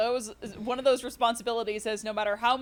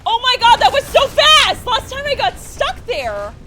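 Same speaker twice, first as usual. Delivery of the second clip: much higher, loud victory yell; triumphant shout; 14 semitones higher, 14 dB louder, slower than usual. Real, not acted. Speedrunner cheering a fast section, triumphant shout. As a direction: explode with joy at the win. Jump succeeds after tension.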